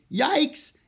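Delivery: high, loud yelp of alarm; startled girl yelling. A narrator's reading. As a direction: yell out in sudden fright. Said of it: "Annie yelled".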